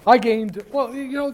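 ordinary talk

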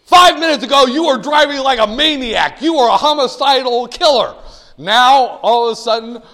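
high, shouted mock indictment; hammed-up scolding at full voice; loud accusation. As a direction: thunder it at the guilty driver.

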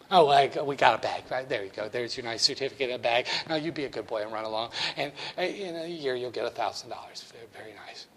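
a hint of a doting clerk sending him off; Mabel the cashier. Cashier Mabel sending the student off, lightly voiced. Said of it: "My name is Mabel".

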